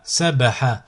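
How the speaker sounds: syllables joined as one word. Shown as a demonstration. Teacher models a word for learners to repeat, joined in one go. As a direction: run the syllables together without pauses. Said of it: "le mot attaché".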